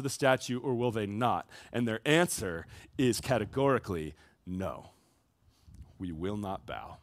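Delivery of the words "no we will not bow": softer and lower, a hushed resolute no; quiet firm refusal. Acted. The three men quietly refusing to bow, hushed and resolute. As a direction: say it low and steady, like a vow.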